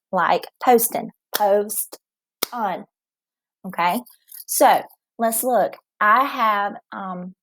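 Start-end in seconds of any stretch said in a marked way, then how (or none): 0.7-2.9 postin broken as post, on; split into syllables. Shown as a demonstration. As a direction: say post and on as separate beats. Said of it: "break a word into parts by the vowel".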